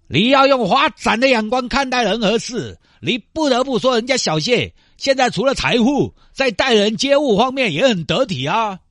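high, slow preachy elder; lecturing elder. Acted. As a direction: talk down like a wise elder. Elder lecturing Xiao Xie. (慢悠悠说教的长辈)

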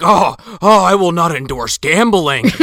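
a pompous Santa putting his foot down; mock prim Santa. Santa refusing the slot machine toy, hammed-up. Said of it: "And he's like".

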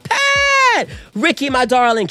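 high, loud squeal of a name; gushing called-out greeting. Acted. Re-enacting greeting Pat McGrath at the party, gushing high shout. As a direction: call out a long, delighted greeting. Acts out greeting a famous guest.

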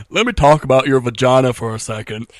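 the brother as a TV doctor, hammed up in a Southern accent; Doctor Phil Southern accent. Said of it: "talked like Doctor Phil".

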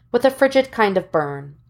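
plain reading voice

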